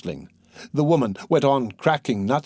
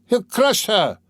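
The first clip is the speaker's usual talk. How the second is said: alarmed shout; 9 semitones higher, slower than usual. A narrator's reading. Bystanders crying out at the raised shaft, alarmed shout. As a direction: warn the crowd in fright. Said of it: "was shouted round him".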